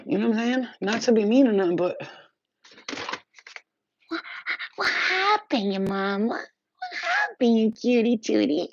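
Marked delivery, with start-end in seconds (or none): 4.1-8.7 much higher, slowed pet-talk coo. Real, not acted. To her cats, cooing in high baby talk.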